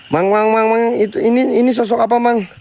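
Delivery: frightened, grabbing a friend's attention; alarmed calling. Real, not acted. Startled, calling companion about figure. (memanggil teman dengan panik)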